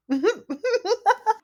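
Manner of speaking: short high laugh. Real, not acted. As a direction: burst out laughing. Laughs at question.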